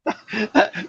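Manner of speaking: high laugh. Real, not acted. Laughs at Russian accent joke.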